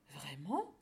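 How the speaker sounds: low start swooping steeply upward; astonished cow. A narrator's reading. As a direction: ask it in disbelief. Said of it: "s'étonna la vache". (vache étonnée)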